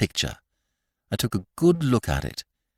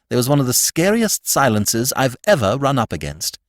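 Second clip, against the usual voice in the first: usual speaking voice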